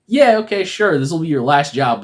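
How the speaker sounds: high, loud opening easing down; put-on dismissive assent; mock smug agreement. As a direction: agree like a jerk with a catch. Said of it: "he's also a dick".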